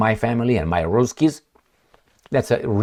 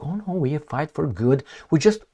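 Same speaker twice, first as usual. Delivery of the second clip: mock innocent; 6 semitones higher, 3 dB quieter than usual. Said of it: "Under the cloak".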